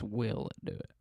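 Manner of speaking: usual speaking voice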